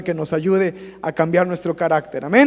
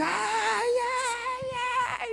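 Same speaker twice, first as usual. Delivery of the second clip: put-on much higher, wailing like a small child; child's tantrum wail; 4 dB quieter, much slower than usual. Pastor imitating a toddler's tantrum, high wailing. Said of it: "Y dice".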